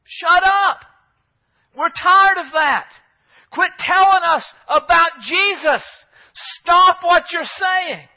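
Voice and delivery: full-force high shout, words drawn out; hammered-out high yell to silence him; angry mob yelling. Acted. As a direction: shout the preacher down as an enraged crowd. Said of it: "they're yelling at him to shut up".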